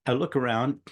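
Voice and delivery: ordinary delivery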